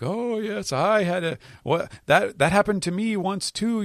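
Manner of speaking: put-on high-pitched old lady, wistfully recalling; reminiscing grandma. Grandma reminisces on her own lovesick past, high and put-on. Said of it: "she is reminiscing".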